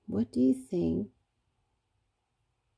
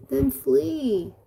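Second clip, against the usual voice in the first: high, urgent read-aloud yell; storybook yell; 6 semitones higher, 3 dB louder than usual. A narrator's reading. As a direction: urge them to escape, pitched high. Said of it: "yelled Dragonfly".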